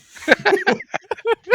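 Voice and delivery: loud high-pitched laugh. Real, not acted. Laughing after joke.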